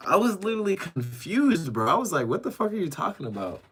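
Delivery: high-pitched, incredulous rush of explanation; baffled disbelief. Real, not acted. Streamer tells chat how confused he was, baffled.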